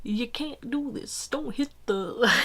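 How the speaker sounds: a failed try at mimicking his accent, quickly dropped; tentative half-voiced impression, trailing off; attempted impression of a movie actor. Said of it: "I can't even do his accent".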